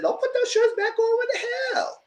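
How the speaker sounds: very high, shouting at the screen; mock outraged shout. Fan yelling at the TV in mock outrage, very high shout. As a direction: yell at them to keep their shirts off.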